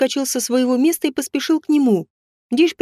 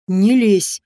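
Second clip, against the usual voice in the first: through gritted teeth; 4 semitones lower than usual. A narrator's reading. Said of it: "сквозь зубы процедил Дейш".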